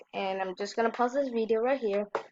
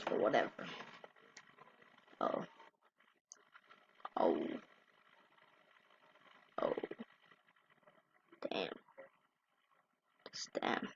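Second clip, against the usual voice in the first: awed whisper; 12 dB quieter than usual. Real, not acted. Reacting to a clip in awe.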